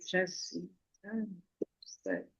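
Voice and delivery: very soft, groaning lament; waking up in the morning, groaning softly; weary morning groan. Retold or imitated. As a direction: moan it like waking up tired. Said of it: "que eu digo".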